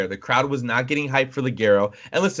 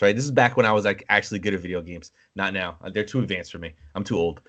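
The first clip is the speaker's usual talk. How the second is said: normal talking voice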